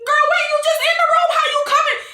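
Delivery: high-pitched, quick mock bewilderment; startled guard. Guard startled by princess running into the room, mock surprise. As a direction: squeal it as the baffled guard.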